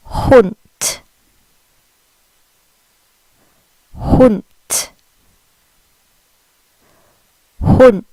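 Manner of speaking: Hund with the final D sounding like a crisp T, falling; German Hund. Shown as a demonstration. Teacher modelling Hund for learners, careful German pronunciation. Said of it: "They pronounce it".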